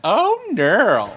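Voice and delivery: high, drawn-out "Oh, neural"; playful exclamation. Pretend. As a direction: stretch "Oh, neural" into a sassy high swoop. Playfully repeats her earlier exclamation.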